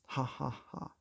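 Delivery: soft, spelled-out fake laughter; ha ha ha with no joy in it; girl agreeing with a hollow laugh; mock laugh. A narrator's reading. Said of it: "agreed the girl".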